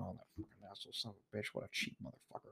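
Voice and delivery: very soft, fast mock grumbling; barely audible, rapid muttered insults; muttering. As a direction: curse under your breath from the queue. Mimics people muttering behind you.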